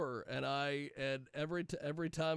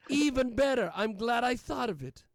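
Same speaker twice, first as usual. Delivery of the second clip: quoting her; 5 semitones higher, 4 dB louder than usual. Retold or imitated. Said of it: "And she goes".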